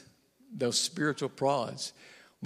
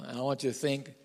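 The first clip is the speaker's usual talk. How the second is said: usual speaking voice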